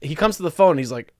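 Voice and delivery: ordinary talk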